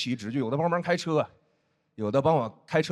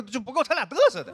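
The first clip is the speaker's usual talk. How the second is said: ordinary talk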